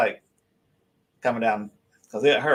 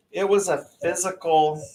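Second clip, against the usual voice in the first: ordinary delivery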